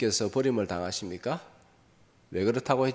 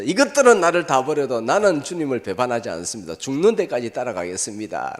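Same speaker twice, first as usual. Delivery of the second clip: raised, proud boasting vow; loud boast; 4 semitones higher, 8 dB louder than usual. Retold or imitated. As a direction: brag loudly of loyalty. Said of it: "호언장담했죠".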